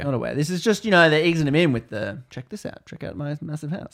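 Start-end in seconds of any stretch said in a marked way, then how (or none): 2.3-3.9 soft, half-whispered invitation; hushed showing-off. Acted. Society easing the newcomers in, hushed showing-off. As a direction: toss it off under the breath. Hosts casually luring him in.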